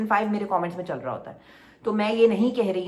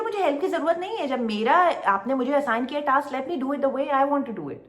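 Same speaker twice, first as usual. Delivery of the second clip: higher-pitched imitation of insistent refusal; 6 semitones higher than usual. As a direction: mimic someone firmly turning down help, pitched up. Acting out Kanwal's refusal of help. (ऊँची आवाज़ में ज़िद भरे इनकार की नकल)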